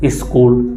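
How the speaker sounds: "स्कूल" as is-kool, pitched low; low-voiced "स्कूल" with an i prefixed; added initial i. Shown as a demonstration. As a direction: say "स्कूल" with a short i before the S. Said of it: "इसका उच्चारण आप क्या बोलते हो".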